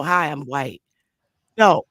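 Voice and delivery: usual speaking voice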